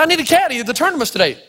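high-pitched protest, retold; insistent plea. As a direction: plead urgently for a caddy. Retells pleading with caddy master.

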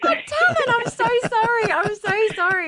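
very high, squeaky apology; horrified sympathy. Real, not acted. Host hearing caller's spider horror, high-pitched dismayed sympathy.

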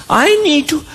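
lightly voiced child, drawn out; a hint of a stalling kid; pleading child. Child begs parent for a drink, mock pleading.

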